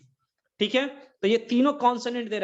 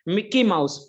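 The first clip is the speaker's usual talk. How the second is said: Mickey mouse with मि hit louder; stress on opening sound; 5 dB louder, slower than usual. Shown as a demonstration. Teacher stressing मि of Mickey for class. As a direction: punch the मि of Mickey. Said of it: "मी".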